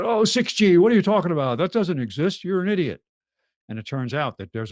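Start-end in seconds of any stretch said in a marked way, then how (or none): 0.0-3.0 high, loud scoffing disbelief; mock outrage. Critics jeering at talk of six G, mock outrage. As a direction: imitate indignant critics, pitched up and pushed out loud.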